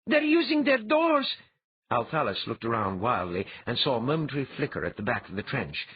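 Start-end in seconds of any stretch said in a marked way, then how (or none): 0.1-1.4 loud, very high cry of alarm; shouted warning. A narrator's reading. She spots attackers at the trench, shouted warning. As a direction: shout it in sudden panic, pitched way up. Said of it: "she shouted".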